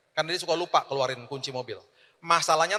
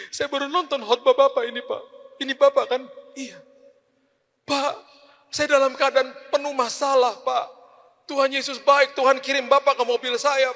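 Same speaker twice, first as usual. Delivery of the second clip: tearful high voice, much higher; crying driver; 10 semitones higher, 6 dB louder than usual. Retold or imitated. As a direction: play the weeping driver pleading. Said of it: "Dia nangis". (sopir menangis terharu, suara tinggi)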